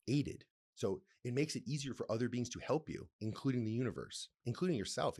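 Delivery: usual speaking voice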